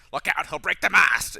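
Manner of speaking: put-on loud, cheesy Pirates of the Caribbean accent; Drinian. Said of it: "cheesy Pirates of the Caribbean kind of accent".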